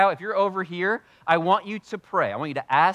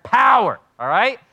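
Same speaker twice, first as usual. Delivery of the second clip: calling out; 7 semitones higher, 10 dB louder than usual. Real, not acted. Assigning prayer sections.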